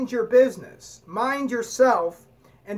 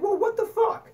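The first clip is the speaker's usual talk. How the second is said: high, disbelieving what the; a squeaky imitated what-the; mock exasperation; 10 semitones higher than usual. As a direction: pitch way up in mock outrage. Imagined exasperated reaction.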